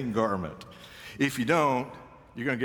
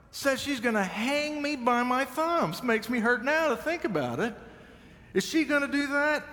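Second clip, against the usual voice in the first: incredulous; 12 semitones higher than usual. Real, not acted. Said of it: "incredulous retelling".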